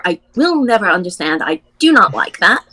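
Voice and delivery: ordinary talk, nothing marked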